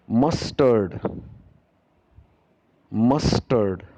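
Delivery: 'mustard' said clearly, twice; model pronunciation. Shown as a demonstration. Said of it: "I will be pronouncing each and every word".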